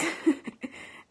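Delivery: a bashful giggle at a compliment; high breathy laugh after being praised; embarrassed laugh. Real, not acted. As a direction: laugh it off, flattered and a little awkward. Flattered by a viewer's comment.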